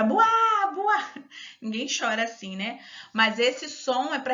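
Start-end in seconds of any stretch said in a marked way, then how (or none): 0.0-1.1 loud, high cartoon wail; hammed-up comic-book bawling; exaggerated fake boo-hoo, pitched way up; mock crying. Shown as a demonstration. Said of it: "E o choro".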